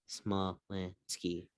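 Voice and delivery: ordinary talk, nothing marked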